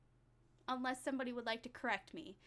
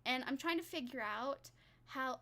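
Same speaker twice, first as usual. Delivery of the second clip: ordinary talk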